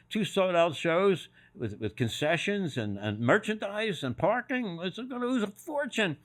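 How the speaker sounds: slow panicked rise, pitching up; rising panic about lost money; panicked pleading. Retold or imitated. Panicked retold plea.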